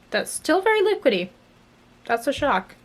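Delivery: high squeak of disbelief; surprised. Real, not acted. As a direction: pitch leaps up in mock shock. Surprised eyeliner still works.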